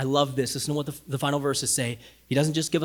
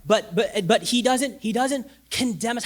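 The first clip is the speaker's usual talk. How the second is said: ordinary talk, nothing marked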